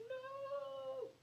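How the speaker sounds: very high, soft falsetto cry; thin, drawn-out mock lament; mock tearful wail. Viewer wanting to cry at the ending, soft mock wail. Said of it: "you wanna cry".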